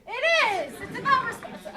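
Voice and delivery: loud, high indignant yell. Acted. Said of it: "Don't yell at me".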